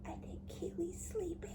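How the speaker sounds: soft unvoiced whisper. Real, not acted. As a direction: whisper so Keely stays asleep. Whispering as child sleeps.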